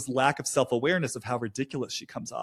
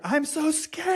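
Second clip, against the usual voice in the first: voice cracking up high; 14 semitones higher, slower than usual. Real, not acted. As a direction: voice breaks and jumps high on the word scared. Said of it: "my voice got".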